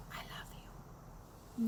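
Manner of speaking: breathy whispered I love you, slow. Shown as a demonstration. Whispering to the rude brother-in-law at the party. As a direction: sweetly whisper the kiss-off.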